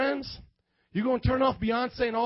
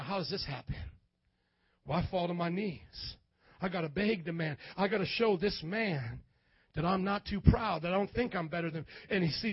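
lower, softer, earnest sermon voice; softer preaching; 5 semitones lower, 6 dB quieter than usual. Acted. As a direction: bring them in close, hushed. Soft low sermon close.

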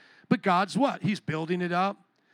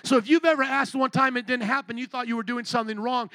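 ordinary talk, nothing marked

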